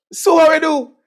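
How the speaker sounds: high and loud, alarmed auntie; excited auntie. Retold or imitated. Auntie asks, in a high loud alarmed voice. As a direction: squeal it like a flustered auntie.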